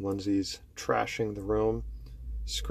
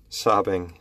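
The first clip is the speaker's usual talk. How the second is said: usual speaking voice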